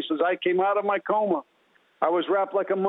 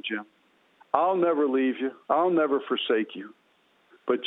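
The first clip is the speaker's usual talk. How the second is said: low, tender, reassuring voice; loving, compassionate; 6 semitones lower than usual. Retold or imitated. Jesus answering a desperate man, low and compassionate. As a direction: speak low and gently, with deep compassion. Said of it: "with loving, kind, affectionate, compassionate words".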